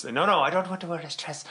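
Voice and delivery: high protesting mock tantrum; mild mock fit; imitated fit. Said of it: "having a fit".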